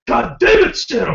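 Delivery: put-on high, loud German-accented curse. Imitating the German neighbor walking in, German accent. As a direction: bark it like the old neighbor. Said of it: "She probably walked in and goes".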